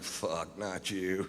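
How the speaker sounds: soft, low, under-the-breath curse; muttered dread. Retold or imitated. Seeing the slow jail guard, a quiet muttered curse. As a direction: say it to yourself, dreading it. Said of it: "I'm like".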